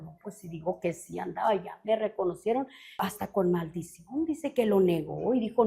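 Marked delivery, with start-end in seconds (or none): 0.0-4.2 fearful hushed denial. Retold or imitated. Peter denying out of fear.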